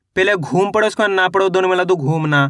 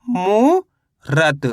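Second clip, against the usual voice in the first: मूरत slow, मू drawn out and rising, pause, then रत; word split in two; much slower than usual. Shown as a demonstration. Teacher reading the finished word to learners, slowly in two parts. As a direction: break मूरत into मू ... रत. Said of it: "तो मिलके बन गया ये".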